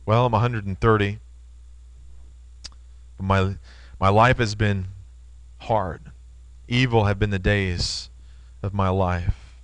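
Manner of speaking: low, soft, heavy with age and sorrow; weary old Jacob. Acted. Old Jacob telling Pharaoh his life was hard, low and weary. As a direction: let each word sink, tired and grieved. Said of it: "Jacob says".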